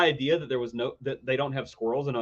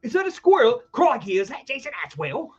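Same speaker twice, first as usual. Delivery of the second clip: mock Aussie awe; 9 semitones higher than usual. Mocking Australian squirrel awe.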